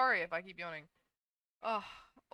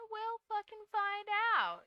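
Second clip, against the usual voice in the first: mock strained voice; 12 semitones higher than usual. Joking about losing her voice.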